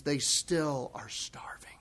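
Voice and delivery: slow, breathy whisper. Real, not acted. Preacher to congregation, slow whisper. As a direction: hush it like a confession.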